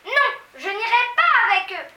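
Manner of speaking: put-on high, loud child's outburst; abrupt cry. A narrator's reading. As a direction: blurt it out as a desperate girl. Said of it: "s'écria brusquement Mathilda".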